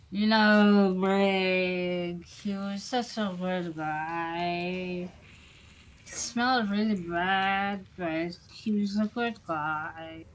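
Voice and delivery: slow, drawn-out bar-regular voice; a hint of a sloppy drinker, loud at the start; lightly voiced drinking buddy. Bar regular draping an arm over a newcomer, slow and drawn out.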